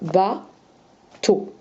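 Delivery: "ba, to" as two detached syllables; syllabes séparées. Shown as a demonstration. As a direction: leave a clear gap inside "ba, to". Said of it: "On sépare". (« ba, to » en deux temps détachés)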